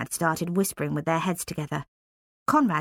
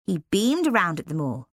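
plain reading voice

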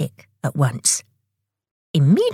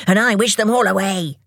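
slightly raised, mild angry yell; yelling in a temper; 4 semitones higher than usual. A narrator's reading. Tock cancelling Tick's wish in a temper. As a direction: snap back crossly, pitched a little higher. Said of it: "yelled Tock in a temper".

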